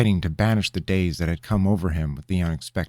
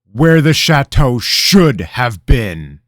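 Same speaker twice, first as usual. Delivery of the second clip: loud, high, slow incredulous echo; king echoing the advisor's words, menacing; 6 semitones higher, 11 dB louder, slower than usual. Acted. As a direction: repeat it like an accusation.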